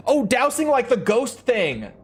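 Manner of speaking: high, excited aha moment; suddenly getting it; surprised realization. Real, not acted. Sudden realisation about dowsing.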